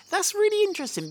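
sudden high, fast burst of delight; excited. Real, not acted. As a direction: leap up with genuine enthusiasm. Preacher struck by scripture detail.

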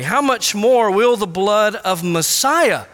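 slow, weighty, landing on "Messiah"; read with Messiah. Shown as a demonstration. Preacher rereading the verse, stressing "Messiah". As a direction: lean on "Messiah" with a falling tune. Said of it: "So read it that way".

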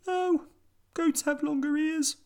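very high squeaky mouse; tiny mouse voice. A narrator's reading. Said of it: "said the mouse".

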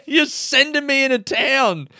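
hammed-up outrage, drawing it out; indignant. Complaining to camper sending him away, indignant wail.

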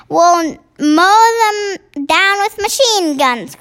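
high, drawn-out military cry; fierce cry. A narrator's reading. Army head urges attack on the giants, crying out. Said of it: "cried the head of the army".

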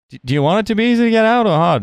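high, quick sitcom-comedian impression; Jerry. Retelling a sitcom scene to co-hosts, high mock Jerry voice. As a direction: imitate a questioning comic, pitched right up. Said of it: "And then Jerry's like".

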